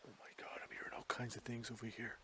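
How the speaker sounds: very soft, listening intently; hushed whisper. Real, not acted. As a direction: whisper so faint sounds stay audible. Listening to faint voices in woods.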